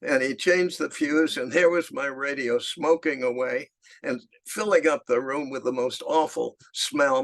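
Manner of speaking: ordinary talk, nothing marked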